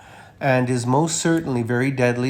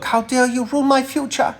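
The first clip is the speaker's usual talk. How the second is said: much higher mock-indignant young activist voice; mocking Greta Thunberg; 12 semitones higher, 3 dB louder than usual. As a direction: parody a furious teen activist, pitched way up. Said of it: "Greta Thunberg".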